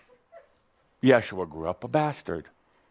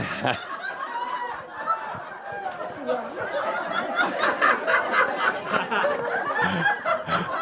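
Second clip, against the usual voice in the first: big laugh. Real, not acted. Audience laughter.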